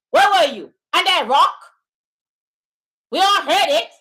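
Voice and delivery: loud, exasperated yelling at chat; loud angry rebuke of a viewer; angry shout. Real, not acted. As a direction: blast the viewer for not knowing. Ranting at a chatter.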